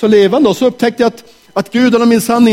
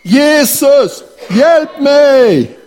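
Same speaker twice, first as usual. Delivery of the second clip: shouting for help; 7 semitones higher, much slower than usual. Acted. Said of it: "En gång till".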